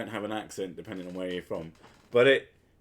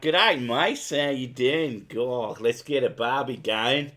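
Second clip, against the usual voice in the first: hammed-up Aussie greeting; broad put-on Aussie accent; mock Australian accent; 4 dB louder, slower than usual. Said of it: "you're like".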